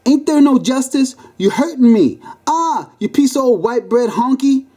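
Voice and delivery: high-pitched outraged wail; hammed-up high cries of grievance; yelling. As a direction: yell in indignant protest, pitched high. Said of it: "the fat lady yelled".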